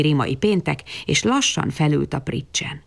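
ordinary delivery, nothing marked

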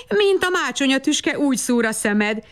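joyful call, high at the start; happy cry. A narrator's reading. Babberci gladly calling to the waking smoke-maker. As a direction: cry out gladly as his friend wakes. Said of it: "kiáltotta boldogan Babberci".

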